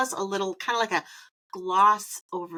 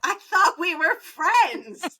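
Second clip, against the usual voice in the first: mock wounded; 10 semitones higher, 5 dB louder, much slower than usual. Mock hurt joke.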